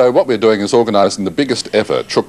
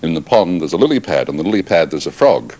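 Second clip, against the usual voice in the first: normal talking voice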